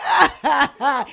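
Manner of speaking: loud fake laughter; hammed-up laughing on set; forced laugh.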